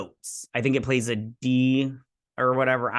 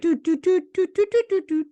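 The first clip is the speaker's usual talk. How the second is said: high, loud staccato dooting; imitated tune in doots; 5 dB louder than usual. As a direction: toot out the tune on one high note.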